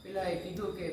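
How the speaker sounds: normal talking voice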